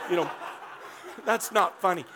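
ordinary talk, nothing marked

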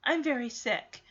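flat, plain statement of illness; matter of fact. Retold or imitated. Girl telling the receptionist she is sick, deadpan. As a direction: state it without any effort. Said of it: "Veda says it so matter of fact".

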